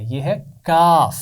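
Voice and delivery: یہ ہے کاف said slowly, with کاف drawn out; slow down and show کاف plainly; slow letter demo. Shown as a demonstration. Teacher shows children how to say کاف slowly. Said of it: "یہاں سے تو تا ادا ہوتا ہے".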